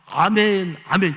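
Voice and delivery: loud fervent cry of amen, twice. Retold or imitated. Convert at the revival meeting, shouting amen loudly. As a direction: call out amen as if moved in the pew.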